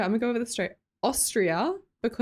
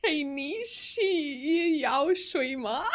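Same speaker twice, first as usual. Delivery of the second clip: slow, higher, halting Mandarin with laughter; drawn-out, giggly try at Mandarin; laughing attempt at Mandarin; 5 semitones higher, much slower than usual. Real, not acted. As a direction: sound out the Mandarin slowly, breaking into laughs. Attempts Mandarin message, then laughs.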